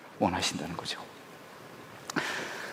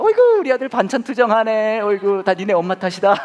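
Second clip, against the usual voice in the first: higher and louder, indulgent cooing; hammed-up doting father; 8 semitones higher, 5 dB louder than usual. Father taking son's side over picky eating, mock doting. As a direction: side with the kid, blame mom playfully.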